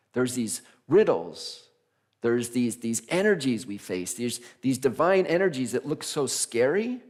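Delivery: normal talking voice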